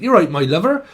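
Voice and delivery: a quick playful burst of rural West Country English; country bumpkin accent. Pretend. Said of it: "talk a bit like a country bumpkin".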